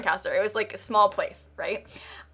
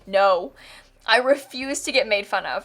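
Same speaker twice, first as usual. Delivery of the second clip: ordinary delivery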